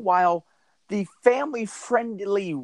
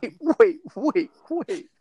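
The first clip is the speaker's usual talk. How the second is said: incredulous; 11 semitones higher than usual. Real, not acted. Stunned by absurd rave detail.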